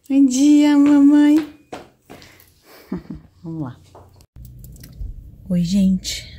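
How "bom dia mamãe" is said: put-on higher, louder little baby voice; mother pretending to be her baby saying good morning; voicing the baby. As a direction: speak for the baby as if she were answering.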